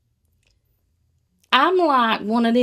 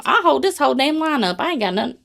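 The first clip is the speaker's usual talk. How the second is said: normal talking voice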